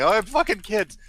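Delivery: high, loud mock panic; mock freak-out. Host mocking a YouTuber's panicked video, high and loud. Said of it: "freaking out and saying".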